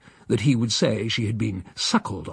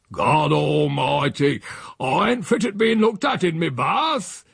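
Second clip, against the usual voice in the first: put-on an old countryman bellowing his modesty; indignant old Silas; 4 semitones higher, slower than usual. Silas to his nephew, objecting to being seen in his bath, slow oath then a shout.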